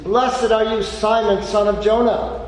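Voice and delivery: loud, high proclamation of blessing; joyful blessing. Acted. Jesus blessing Peter, loud and joyful.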